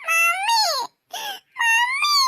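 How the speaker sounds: high wailing call for mummy; crying for mummy. Acted. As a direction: wail mummy like a hurt little girl. Hurt child calling for mummy. (കരഞ്ഞുകൊണ്ട് മമ്മിയെ വിളിക്കുന്നു)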